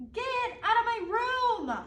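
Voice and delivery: put-on loud, high childish shout. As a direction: yell at the little brother to get out. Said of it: "So I shouted".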